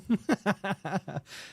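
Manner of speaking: quick run of chuckles; real laugh. Real, not acted. Streamer teasing a player about playing piano, amused chuckle. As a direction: chuckle freely at your own joke.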